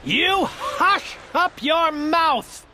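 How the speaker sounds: slow, high outraged royal command; howling king. A narrator's reading. Yertle silences Mac below, slow outraged howl. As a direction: bellow down at the complainer. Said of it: "howled the mighty King Yertle".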